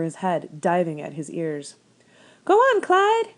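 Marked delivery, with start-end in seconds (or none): 2.4-3.3 put-on much higher, louder old woman calling out. A narrator's reading. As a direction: holler it at the rabbit like a farm wife. Old woman urging scarecrow rabbit.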